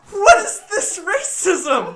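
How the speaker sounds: high, very loud, drawn-out outrage; incredulous yell. Retold or imitated. Reacting to an actor's accent, loud incredulous yell. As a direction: yell it slowly in mock disbelief.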